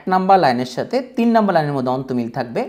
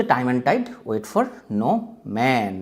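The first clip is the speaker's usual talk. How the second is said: slow quotation; 4 dB quieter, much slower than usual. Shown as a demonstration. Teacher quotes Chaucer's famous line.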